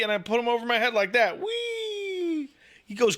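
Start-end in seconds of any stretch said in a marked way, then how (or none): 1.4-2.5 playful whee. Retold or imitated. Lifting baby overhead playfully.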